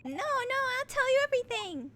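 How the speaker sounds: high, loud frightened begging; cowardly character cracking under threat; panicked plea. Acted. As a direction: blurt it out in acted panic.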